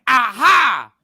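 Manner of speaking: high, loud taunting yell breaking into laughter; gloating shout. Acted. Man crowing to his returning ex, loud and laughing. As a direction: crow it at her, laughing through the words.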